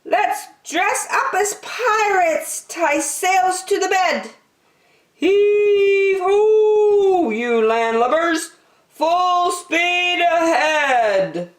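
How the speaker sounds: put-on high kid voice, heave ho sung out loud; children playing pirates, sung and high; playful child pirates. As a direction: shout orders like a tiny captain. Kids play pirates.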